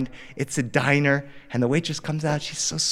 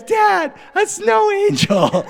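put-on high, loud excited toddler squeal; shrill-pitched, drawn-out kid's shout to dad; excited little kid; 6 dB louder, slower than usual. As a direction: imitate a thrilled small boy calling out. Excited child calling to his father.

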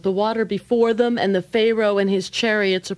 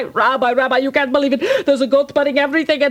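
frantic poor man; 7 semitones higher, much faster than usual. Acted. Poor man frantically complaining to the rabbi. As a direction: rattle it all off in a panic.

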